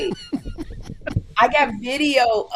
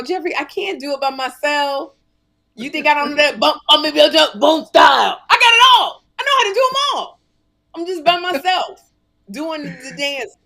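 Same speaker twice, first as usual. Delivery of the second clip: higher and louder, building to a near shout; excited pleading; 5 semitones higher, 7 dB louder than usual. Retold or imitated. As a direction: beg the son, getting more worked up. Pleading with son to dance.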